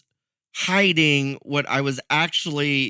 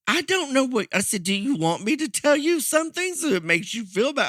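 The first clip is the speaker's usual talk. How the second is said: higher, pleading exasperation; what do you even want from me; a mock plea from a man at his wits' end; exasperated retort; 9 semitones higher, faster than usual.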